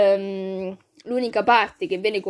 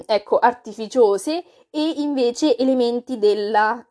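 ordinary delivery

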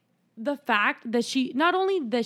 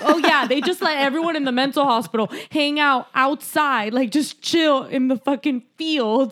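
drip with sarcasm; sarcastic; 4 semitones higher, 4 dB louder than usual. Retold or imitated. Sarcastic retort to the nurse's question. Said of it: "I'm like".

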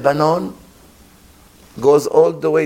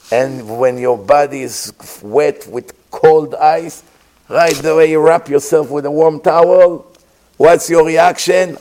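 usual speaking voice

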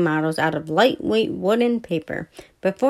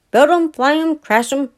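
cheerful motto; 7 semitones higher, 5 dB louder than usual. A narrator's reading.

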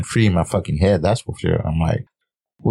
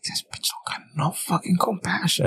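breathy mock whisper, higher and much softer; 10 semitones higher, 8 dB quieter than usual. Husband teasing his wife on the podcast, in a mock whisper. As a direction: hiss it like a secret aside.

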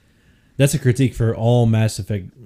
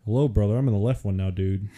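low, soft wrestler voice; a hint of Hulk Hogan, quiet; Hulk Hogan impression; 4 semitones lower, 5 dB quieter than usual. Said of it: "if Hulk Hogan was talking".

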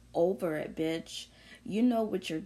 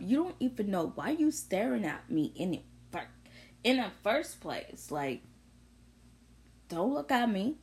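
ordinary talk

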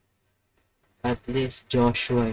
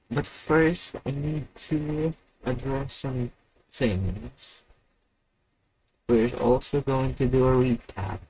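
usual speaking voice

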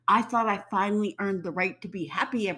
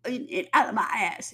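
Cartman; 3 semitones higher than usual. Retold or imitated. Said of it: "feel like Cartman on South Park".

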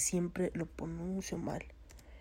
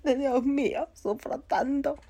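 higher, wailing "sufro tanto" dragged out; mock suffering lament; 7 semitones higher, 4 dB louder than usual. Woman moaning about her pain, in mock suffering.

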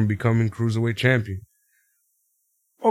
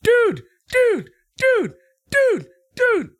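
very high, strained panicked yelling; mock panicked yelling; much slower than usual. Manager yelling to stop the fight, panicked mock shrieks. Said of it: "until Drake starts yelling".

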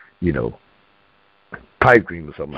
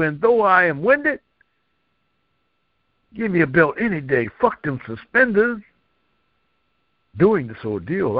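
rhythmic poetry performance, with pitch that rises high on the punchlines; spoken-word poem; 7 semitones higher than usual. Acted. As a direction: deliver it like a slam poet on stage.

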